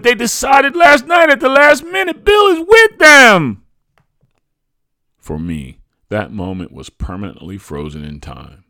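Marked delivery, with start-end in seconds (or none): 0.0-3.6 very high, loud frantic shout; shouted retort. A narrator's reading. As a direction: scream back your answer. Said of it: "my mother retorted".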